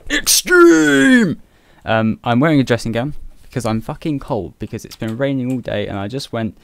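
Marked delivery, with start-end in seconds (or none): 0.1-1.4 very high, loud squeal on Extreme; high squealed Extreme much louder; squeaky shout. Acted. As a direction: shriek the word Extreme like a hype man. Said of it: "extreme cat catching".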